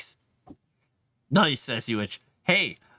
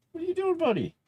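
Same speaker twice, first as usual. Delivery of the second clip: much higher, sing-song call to the cat; pet voice. Real, not acted. Calling up to the cat.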